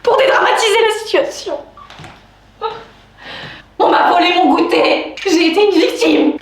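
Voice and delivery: high-pitched, aggrieved protest; indignant wail. Real, not acted. Woman complains to viewers that her snack was stolen, indignant. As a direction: wail it like a wronged victim. Comic outrage at being the victim.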